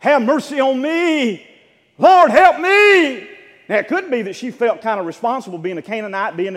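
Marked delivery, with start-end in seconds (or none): 0.1-3.3 high, loud, very slow Lord, help me; crying plea. Acted. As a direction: cry it out, dragging each word. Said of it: "Two times".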